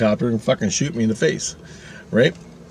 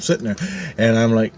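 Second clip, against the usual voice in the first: ordinary talk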